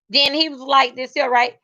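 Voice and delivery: usual speaking voice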